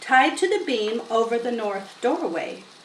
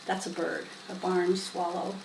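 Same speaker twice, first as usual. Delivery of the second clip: usual speaking voice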